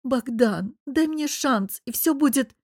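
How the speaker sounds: high-pitched, pleading woman's voice; tearful plea. A narrator's reading. Anya begs Bogdan for a chance, tearfully pleading. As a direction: plead with a touch of a sob. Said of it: "всхлипнула".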